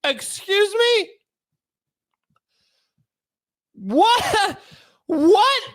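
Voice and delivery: high, loud, slow desperate begging; pleading shout. Pretend. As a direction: beg the judge to lock you up, yelling.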